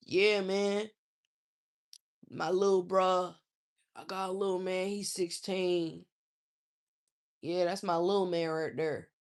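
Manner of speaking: lightly voiced hoodlum talk; imitating slangy man. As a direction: mimic a grown man talking street slang. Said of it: "you're thirty-nine talking like this?".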